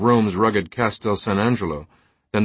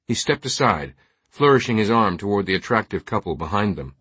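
ordinary delivery

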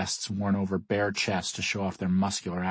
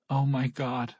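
higher-pitched, contained cry of shock; 5 semitones higher, slower than usual. A narrator's reading. Kat seeing the trophy wall, shocked cry. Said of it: "I hear Kat cry".

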